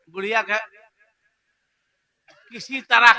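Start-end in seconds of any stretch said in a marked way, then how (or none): none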